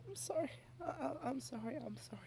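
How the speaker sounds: normal talking voice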